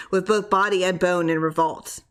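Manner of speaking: plain reading voice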